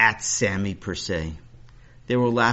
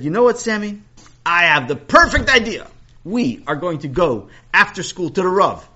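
loud, eager announcement; excited; 9 semitones higher, 10 dB louder than usual. Acted. Principal announces great idea.

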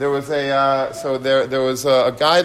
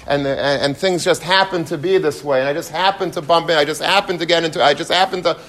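ordinary delivery

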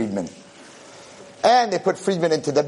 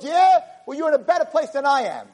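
high, indignant comeback; cranky old-man retort; acted bickering; 10 semitones higher than usual. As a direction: fire back up high in a grumpy quarrel.

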